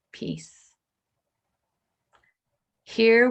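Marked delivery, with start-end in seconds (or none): 0.1-0.8 a soft voiced whisper of peace. Minister voicing the inner voice to the congregation, whispered. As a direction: breathe the word out in a whisper.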